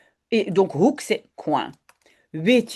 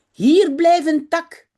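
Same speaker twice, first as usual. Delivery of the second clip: higher, louder call to the dog; 6 semitones higher, 6 dB louder, slower than usual. Acted. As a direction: call the dog back firmly. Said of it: "zegt Jip".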